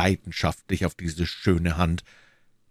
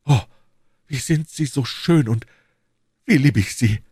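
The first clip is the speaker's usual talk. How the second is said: adoring outburst, louder at the end; rapturous exclamation; 7 semitones higher, 4 dB louder than usual. A narrator's reading. Duke overcome by the queen's beauty, rapturous. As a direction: swell into a declaration of love. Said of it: "rief Buckingham".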